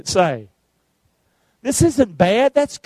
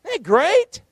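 usual speaking voice

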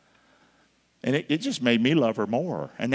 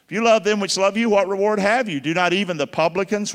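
louder preaching quote; scripture recitation; 7 semitones higher, 6 dB louder than usual. Acted. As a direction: proclaim the verse. Quoting scripture.

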